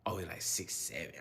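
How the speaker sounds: put-on breathy giggling kid aside; mock the coach under your breath; whispered kid mockery. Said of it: "kids mocking him".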